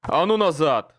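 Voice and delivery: loud barked order; barked command. A narrator's reading. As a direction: bark them back. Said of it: "рявкнул он".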